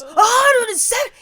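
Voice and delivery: high, loud mock-outraged yell; shocked outburst. Teller imitating her husband seeing the price tag, shocked outburst. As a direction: blurt it out in loud, high disbelief.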